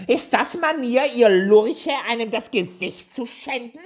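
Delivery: very high, loud screeching rage; wütender Zwerg; furious dwarf shouting. A narrator's reading. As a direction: scream insults at the girls. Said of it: "schrie er sie an".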